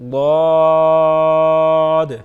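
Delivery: غاد held very long and loud; drawn-out letter name. Shown as a demonstration. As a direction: stretch غاد slowly, full voice. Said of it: "اب پڑھتے ہیں غاد کو". (غاد کو لمبا کھینچ کر بلند آواز میں)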